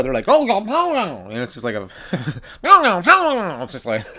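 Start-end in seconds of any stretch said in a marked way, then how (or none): 0.3-1.3 nasal alien voice. Retold or imitated. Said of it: "really nasally". 2.6-3.6 slow, high alien wail of no; nasal alien voice. Retold or imitated. A comic's alien slipping and calling out in a slow, high voice. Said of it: "really nasally".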